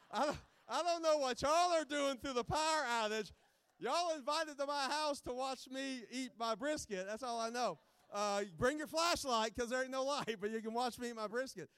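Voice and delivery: loud, playful bragging to the crowd; excited joking. Real, not acted. Joking to crowd about brisket.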